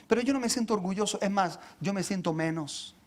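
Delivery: ordinary delivery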